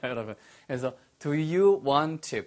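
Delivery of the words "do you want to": very slow, each word separate; careful teacher's model, 'want to' unmerged; slow, full form. Shown as a demonstration. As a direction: stretch out 'Do you want to' word by word. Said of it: "빨리 하면".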